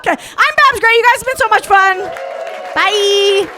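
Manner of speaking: high, loud goodbye to a crowd; loud sign-off. Real, not acted. Comic ending her set to the audience, loud sign-off. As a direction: call out your thanks over applause.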